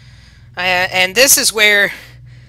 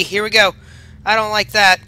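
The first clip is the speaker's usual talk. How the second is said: usual speaking voice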